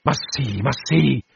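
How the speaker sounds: anguished repeat, rising in pitch; acted wounded insistence; sobbing cry. A narrator's reading. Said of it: "con un singhiozzo terribile".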